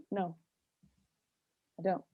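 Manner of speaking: deadpan no, leave it hanging; blunt no. Retold or imitated. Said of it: "And I just go".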